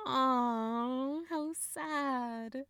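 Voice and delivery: mock-sympathetic aww; teasing pity. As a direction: coo at your poor little brother.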